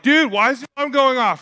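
mock-annoyed, high-pitched complaint; exasperated family member. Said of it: "Everyone hates me. Like my family, they're like".

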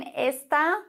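esta with stress on the last syllable, es-TA; esta, no accent. Shown as a demonstration. Teacher showing unaccented esta to German learners. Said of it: "das hat keinen Akzent".

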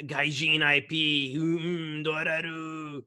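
slow, drawn-out Japanese accent impression. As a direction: speak it like a Japanese executive, slowly. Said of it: "Excelente imitação do sotaque japonês". (sotaque japonês carregado e lento)

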